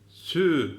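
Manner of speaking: "Sü" with tongue tip touching the upper teeth, th-like; lisped sü. Shown as a demonstration. Teacher demonstrating tongue placement for lisped letter. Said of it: "üst dişlerin ucuna temas ettirerek okuyoruz".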